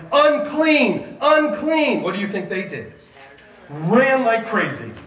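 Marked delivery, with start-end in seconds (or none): 0.1-2.0 high, loud warning cry; raised, urgent shout; yelling. Retold or imitated. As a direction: yell it out like a leper running at a crowd. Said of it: "toward them yelling".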